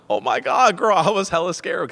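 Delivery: gasping, high, loud panic; gasp, then blurt it out high and loud as if scared for her; acted scared relief. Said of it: "'Cause I was hella scared for her".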